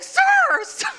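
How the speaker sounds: very high, loud calls, breaking into a laugh; calling out. Retold or imitated. Woman banging on a truck window, calling out high and loud.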